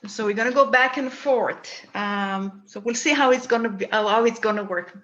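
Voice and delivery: normal talking voice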